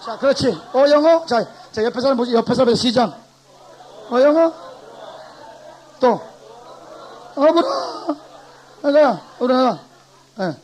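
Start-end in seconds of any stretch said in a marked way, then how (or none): 0.5-1.2 loud, high barking gate guard; gatekeeper. Shown as a demonstration. Teacher plays a gatekeeper turning away French speakers: loud barking guard. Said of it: "수문장 실시". 4.1-9.8 loud, high barking gate guard; gatekeeper. Shown as a demonstration. Teacher plays a gatekeeper turning away French speakers: loud barking guard. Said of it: "수문장 실시".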